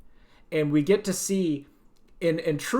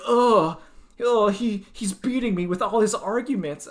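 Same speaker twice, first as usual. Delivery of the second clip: mock defeated girl; 5 semitones higher, 3 dB louder than usual. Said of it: "Kigali's like".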